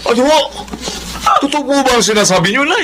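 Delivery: high, fast, alarmed questions; shocked. Acted. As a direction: disbelief rushing out. Son hears shocking news.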